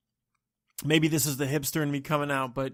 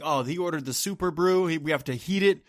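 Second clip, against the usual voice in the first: mock barista; 4 semitones higher, 5 dB louder than usual.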